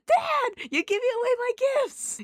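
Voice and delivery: put-on shrill, very high child's shriek at dad; kid screaming. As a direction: wail like a kid robbed of his presents. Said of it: "Chucky's the one who screams".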